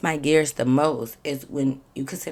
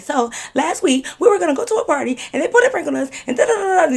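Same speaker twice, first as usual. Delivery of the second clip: fast, high, laughing ramble; rushed mock explanation; 11 semitones higher, 5 dB louder, faster than usual. Mocking the long story she didn't want to tell, fast and high. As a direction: gabble it off like a bored summary. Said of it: "I don't feel like being like".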